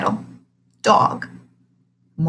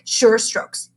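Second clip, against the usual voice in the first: plain reading voice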